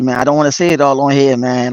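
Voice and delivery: normal talking voice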